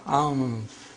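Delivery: "Anf" with a held nasal before f; ikhfa. Shown as a demonstration. Teacher repeating the ikhfa example, long nasal n in "Anf". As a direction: hold the hidden n of "Anf", no tongue tip. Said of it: "This is ikhfa".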